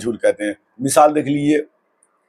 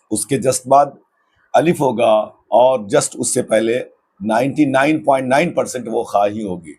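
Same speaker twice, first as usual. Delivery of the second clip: normal talking voice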